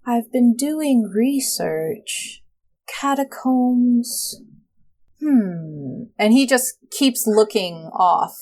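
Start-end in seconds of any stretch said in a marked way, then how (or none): 0.1-6.0 slow, drawn-out words rising at the end; faraway, wistful character. Acted. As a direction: drift off slowly as if lost in thought. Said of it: "he just gets a faraway look".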